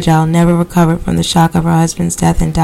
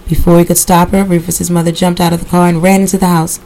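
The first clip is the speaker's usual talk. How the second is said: usual speaking voice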